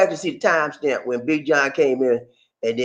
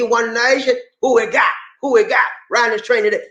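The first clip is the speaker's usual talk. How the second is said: usual speaking voice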